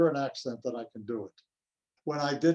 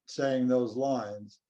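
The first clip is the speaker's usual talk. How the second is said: ordinary talk, nothing marked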